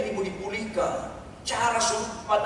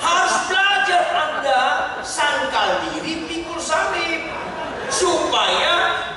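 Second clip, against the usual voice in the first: a loud, higher call to the congregation; preaching shout; 8 semitones higher, 3 dB louder, slower than usual. Real, not acted. Preacher calls out to the congregation, shouting. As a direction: drive the command home. Sermon climax exhortation.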